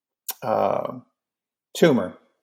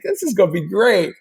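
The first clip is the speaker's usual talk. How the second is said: high-pitched gleeful anticipation; giddy, hammed-up enthusiasm; nerdy glee; 9 semitones higher than usual. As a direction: gush like an excited kid, pitched way up. Nerd thrilled about lasers.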